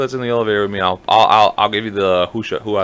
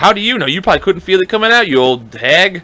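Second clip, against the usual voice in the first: mocking; 8 semitones higher, 4 dB louder than usual. Mocking the granny in elevator.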